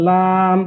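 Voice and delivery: লাম with a lengthened aa; letter-name pronunciation. Shown as a demonstration. Teacher showing how the letter is pronounced, lengthened লাম. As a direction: hold the vowel in লাম, then close on m. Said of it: "লাম উচ্চারণ করা".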